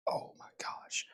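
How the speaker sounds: breathless whispered gasp. Real, not acted. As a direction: whisper it under strain. Strained touching toes.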